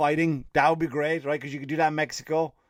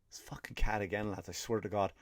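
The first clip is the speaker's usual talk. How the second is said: hushed annoyed whisper about the cat; whispered aside; 6 semitones lower, 10 dB quieter than usual. Real, not acted. Whispering to viewers about the intruding cat. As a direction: mutter it under your breath, irritated.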